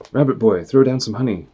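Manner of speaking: put-on character calling to Rabbit Boy for honey, loud. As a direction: shout the order down.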